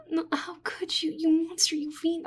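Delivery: high, breathy hammed-up accusation; melodramatic outrage. Slime researcher scolding the adventurer over ruined notes, hammed-up outrage. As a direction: call them a monster in mock horror.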